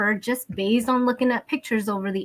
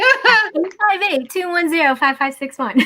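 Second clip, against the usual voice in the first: mock ad pitch; 9 semitones higher, 5 dB louder than usual. Jokingly plugs her phone number.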